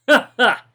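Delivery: two high, loud ha-has; brief mocking cackle; short chuckles. A narrator's reading. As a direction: punch out a quick, acted double laugh. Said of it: "Bruce laughs a few more weak chuckles".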